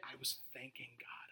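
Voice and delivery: breathy hushed confession; whispered. Real, not acted. Pastor recalling thanking God in illness, whispered. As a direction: tell it as a secret.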